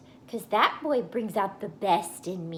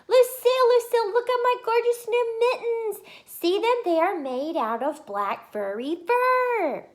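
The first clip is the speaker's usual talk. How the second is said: high, loud, eager call to a friend; excited calling; 14 semitones higher, 8 dB louder than usual. Acted. Junie running up to Lucille to show her mittens, excited loud call. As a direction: call her name across the yard, bursting with excitement.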